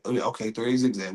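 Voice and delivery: low, unsure echo of the coach; muted acknowledgement. Acted. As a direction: take the play in quietly. Acted player repeats the play.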